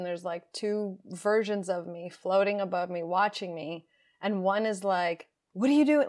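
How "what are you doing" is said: high, loud panicked cry; terrified, panicked. Retold or imitated. Frightened inner self confronting her while she packs, panicked and loud. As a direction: blurt it out in alarm as the frightened self. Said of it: "Like, s-, terrified, completely panicked".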